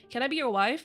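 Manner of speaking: usual speaking voice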